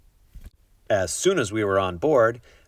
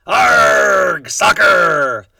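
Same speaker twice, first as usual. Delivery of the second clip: loud, higher pirate shout; pirate yelling; 11 semitones higher, 10 dB louder, much slower than usual. A narrator's reading. Braidbeard kicking the ball on deck, loud pirate yell. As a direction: bellow it while kicking the ball.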